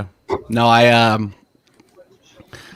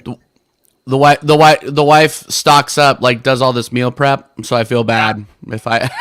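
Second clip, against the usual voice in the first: normal talking voice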